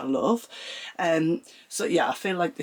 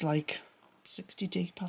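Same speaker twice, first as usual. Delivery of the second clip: whisper; 3 semitones higher, 8 dB quieter than usual. Real, not acted. Aside about the desk depth.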